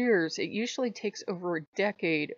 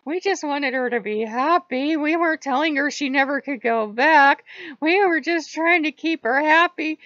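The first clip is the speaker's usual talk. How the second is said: high, loud pleading demon chorus; whiny demons; 8 semitones higher, 8 dB louder than usual. Retold or imitated. Demons defending themselves to Odin, high and loud. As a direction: plead like scolded demons, pitched up. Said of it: "And the demons are like".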